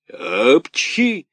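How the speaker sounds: high, loud sneeze burst; loud theatrical sneeze. A narrator's reading. Karabas sneezing while scolding, loud sneeze. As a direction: let the sneeze explode. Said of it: "чихнул Карабас-Барабас с таким шумом".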